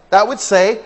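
ordinary delivery

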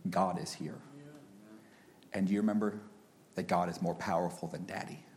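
soft, low fatherly reassurance; soft comfort to a frightened four-year-old; comforting his son. Retold or imitated. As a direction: hush a scared child on your lap. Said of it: "I looked at him and said".

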